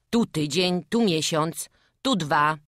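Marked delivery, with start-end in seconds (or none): none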